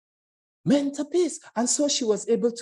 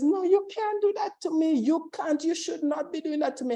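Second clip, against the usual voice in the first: higher, pleading protest; 6 semitones higher than usual. Acted. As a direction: push back, higher and pleading. Paula protesting in acted story.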